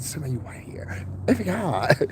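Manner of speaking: whispered aside; hushed mocking whisper. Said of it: "It's like".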